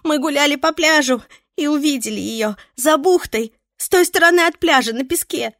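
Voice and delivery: high, loud, breathless and flustered; shaken tearful witness. Acted. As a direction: blurt it out in a panic. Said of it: "сбивчиво рассказывала взъерошенная девушка".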